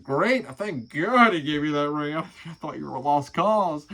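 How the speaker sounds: loud, relieved mock dad; dad loudly relieved about the ring. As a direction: blurt it out with relief. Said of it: "he's like".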